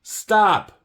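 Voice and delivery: very high, loud cry of stop; stop shout. Acted. Owl shouting at chattering Early Bird to stop. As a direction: cut her off sharply.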